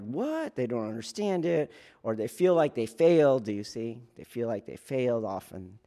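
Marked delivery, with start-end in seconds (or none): 0.0-0.6 much higher, softer bewildered "What?"; lost and baffled, rising; confused recruit. Retold or imitated. A recruit loses his bearing, bewildered high "What?". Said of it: "they kinda like".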